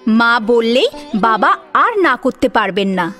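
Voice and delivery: usual speaking voice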